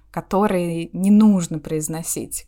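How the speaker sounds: normal talking voice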